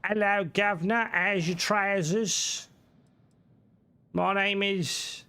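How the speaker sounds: high, drawn-out mock English accent; hammed-up cheeky English accent, stretched slowly; put-on English accent. Shown as a demonstration. Streamer showing off his really good English accent, badly overdone. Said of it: "a really good English accent".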